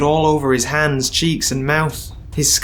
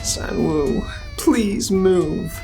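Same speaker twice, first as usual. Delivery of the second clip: much higher, crying plea; tearful plea; 5 semitones higher, 3 dB quieter, much slower than usual. Acted. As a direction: beg through tears. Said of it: "Big fat tears began rolling".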